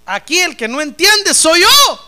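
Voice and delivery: loud, high and slow, hammering it out; slow loud confession. Real, not acted. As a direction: shout it out slowly as your own admission. Preacher's confession to the congregation.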